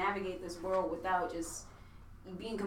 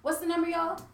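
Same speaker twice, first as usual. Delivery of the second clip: higher and louder, calling across the room; host shouting the question to the room; 8 semitones higher, 7 dB louder than usual. Real, not acted. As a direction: call out to the crew.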